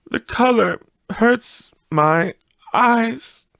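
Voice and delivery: high, slow weary mother's voice; high soft mother. Acted. The mother hiding her tears from the children, high and slow. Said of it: "she said".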